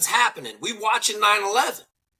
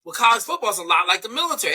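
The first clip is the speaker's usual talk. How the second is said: normal talking voice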